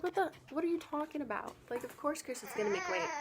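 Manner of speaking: quick, high-pitched mock disbelief; incredulous retort. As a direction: retell it as a baffled, rapid comeback. Retold incredulous reply to demand.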